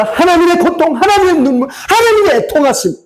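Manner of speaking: high-pitched impassioned preaching; anguished lament. Real, not acted. Preacher mourns God's pain and tears, anguished lament. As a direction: cry out each phrase, pitched high.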